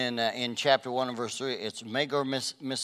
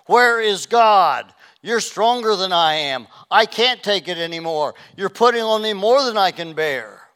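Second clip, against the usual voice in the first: anguished cry; 6 semitones higher, 10 dB louder than usual. Retold or imitated. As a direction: cry out as if at the end of your rope. Voiced cry of a despairing believer.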